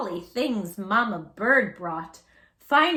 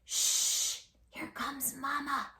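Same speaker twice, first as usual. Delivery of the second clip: urgent whispered hush; hissed whisper; 4 semitones higher, 6 dB quieter, slower than usual. Acted. Baby bird Pip warning his siblings, whispered hiss. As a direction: hush the others before Mama hears. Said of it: "hissed Pip".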